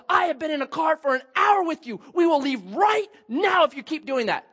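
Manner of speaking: retold mom yelling; imitating yelling. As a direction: mom scolding her daughter, mild mock yell. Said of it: "Just yelling, yelling".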